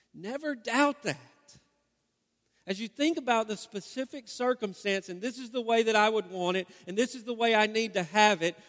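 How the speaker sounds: loud, high, driving sermon voice; a preacher building to a climax; preaching at full force. Acted. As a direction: press the promise home with fervour. Sermon climax, preacher pressing hard.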